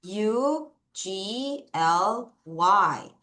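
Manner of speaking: letter by letter, very slow; slow deliberate spelling for students. Shown as a demonstration. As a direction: spell each letter out clearly for the class. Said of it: "발음 어떻게 해요?".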